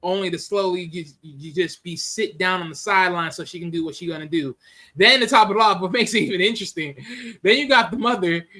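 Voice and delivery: ordinary delivery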